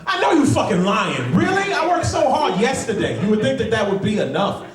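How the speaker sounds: very high-pitched, incredulous whine; indignant waking protest. Acted. Depressed man argues with a new morning, in high indignant disbelief. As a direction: protest in a hammed-up squeal.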